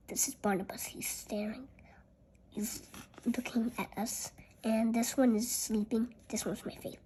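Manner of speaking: very soft whispering; hushed picture chat; whispered aside. Real, not acted. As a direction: murmur to yourself over the page.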